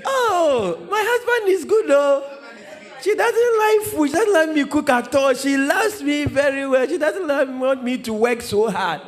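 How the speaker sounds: high, sing-song boasting wife with laughs; mock proud wife. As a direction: brag sweetly about the husband who never eats. Wife boasting about husband.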